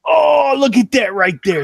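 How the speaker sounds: high, loud "oh" easing down; real excitement at a lucky find; delighted discovery. Real, not acted. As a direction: exclaim as if you struck gold. Finds the cable he needed.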